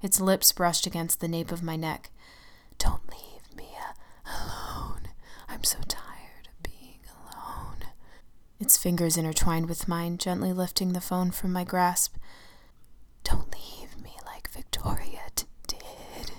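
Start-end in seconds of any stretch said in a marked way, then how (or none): 2.8-7.9 slow, tearful ghostly whisper; pleading whisper. Acted. The lonely shadow pleads. 13.2-16.3 slow, pleading ghost whisper; begging whisper. Acted. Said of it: "the shadow begged".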